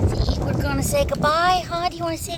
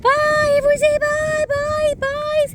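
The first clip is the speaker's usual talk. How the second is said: much higher, louder goodbye yell; cheerful shout; 13 semitones higher, 4 dB louder, slower than usual. Real, not acted. Owner and dogs sign off to viewers, loud cheerful shout. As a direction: shout bye to the camera.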